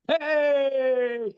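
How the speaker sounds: high, bright-eyed friendly hey; excited greeting. Retold or imitated. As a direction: call out happily on recognizing a fan. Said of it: "and they're like".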